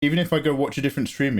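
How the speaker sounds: ordinary talk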